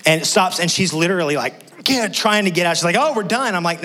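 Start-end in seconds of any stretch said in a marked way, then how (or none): 3.0-3.5 high, hopeful daughter's voice; relieved child. Retold or imitated. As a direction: sure it's finally over. Daughter relieved ride stops.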